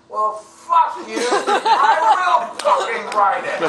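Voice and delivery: very loud, high, drawn-out defiant shout; furious retort. Retold or imitated. Actor yelling after the departing director, hammed-up fury. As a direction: bellow it after him, stretching the words.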